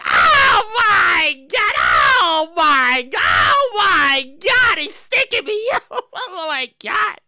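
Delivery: slow, drawn-out mock wail; hammed-up panic, breaking into a laugh near the end; panicking teller. Teller passed a note by masked man, mock panicked wail. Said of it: "She automatically go".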